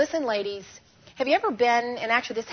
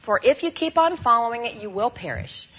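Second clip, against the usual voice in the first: scripture recited aloud; 4 dB louder than usual. Shown as a demonstration. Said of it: "Ready? maybe you can say it with me now".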